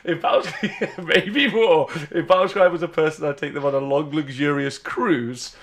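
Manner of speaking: giggling through the words; laughing. Real, not acted. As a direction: laugh while you talk. Joking about loving Battlescribe.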